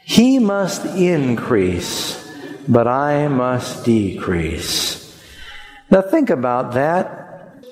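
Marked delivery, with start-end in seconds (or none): 0.0-5.0 very slow, word by word with the people; slow congregational recital. Shown as a demonstration. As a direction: draw out He must increase for all to follow. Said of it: "Would you say that with me?".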